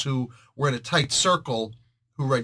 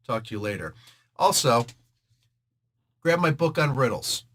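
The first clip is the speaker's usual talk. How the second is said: ordinary delivery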